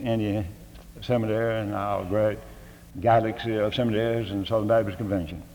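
usual speaking voice

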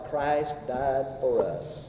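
slow, low and soft; tender reverent quote. Acted. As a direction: linger tenderly on each word. Savoring sweetest words.

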